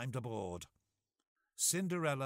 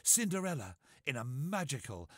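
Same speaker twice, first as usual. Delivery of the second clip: usual speaking voice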